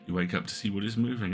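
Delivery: normal talking voice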